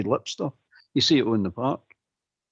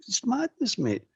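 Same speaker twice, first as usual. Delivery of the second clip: exasperated; 11 semitones higher than usual. Real, not acted.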